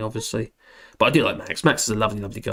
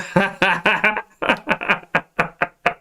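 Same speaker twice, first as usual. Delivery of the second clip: long laugh; 4 semitones higher than usual. Real, not acted. Laughing at chat.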